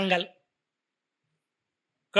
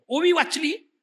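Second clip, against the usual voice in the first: normal talking voice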